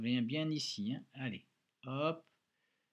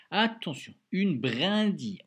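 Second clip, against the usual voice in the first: loud playful warning, brindille stretched slowly; playful teacher alarm at a hard word; mock alarm; 5 semitones higher, 9 dB louder, slower than usual. Shown as a demonstration. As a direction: sound the alarm for a tricky word. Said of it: "Ouh là là là".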